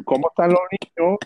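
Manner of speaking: high, loud put-on Cuban accent; put-on Cuban Spanish accent. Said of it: "your fake Cuban accent".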